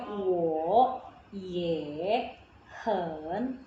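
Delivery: "wo, ye, hen" slowly, each fully dipping then rising; original third tones. Shown as a demonstration. Teacher shows how it should be, slow dipping "wo, ye, hen". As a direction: stretch "wo", "ye", "hen" apart in full third tones. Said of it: "Kan harusnya".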